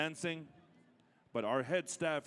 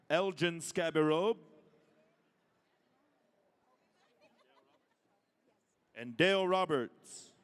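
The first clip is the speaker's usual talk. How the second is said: powwow emcee; 3 semitones higher, 4 dB louder than usual. Acted. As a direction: boom each name to the arena. Calling names at a powwow.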